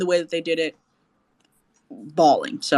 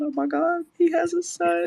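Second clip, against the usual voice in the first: high retold shock; 12 semitones higher than usual.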